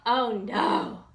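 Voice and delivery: alarmed realization; startled bear gasping; gasped. A narrator's reading. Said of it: "gasped Big Bob".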